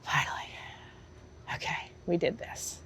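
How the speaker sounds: whispered, soft disbelief; hushed relief. Retold or imitated. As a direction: let the relief out under your breath. Reliving the moment of relief.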